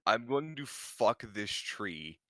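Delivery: usual speaking voice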